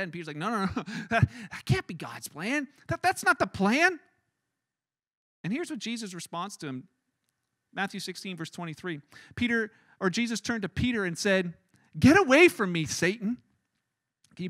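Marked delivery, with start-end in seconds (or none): 0.4-4.0 flustered objection. Acted. Peter refuses Jesus's plan to suffer and die, flustered objection. 12.0-13.4 loud rebuke. Acted. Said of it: "Jesus loudly says".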